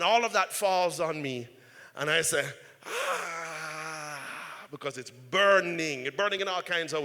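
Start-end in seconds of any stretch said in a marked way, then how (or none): 2.8-4.2 pained cry. Retold or imitated. Scalding sauce spills on him.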